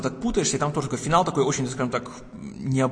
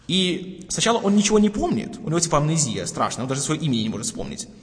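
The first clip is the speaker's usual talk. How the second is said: usual speaking voice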